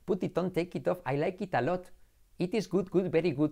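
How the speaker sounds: put-on English with a heavy Spanish accent; mock Spanish-accented learner English. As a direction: imitate a Spaniard's syllable-by-syllable English. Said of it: "si vas con el acento español".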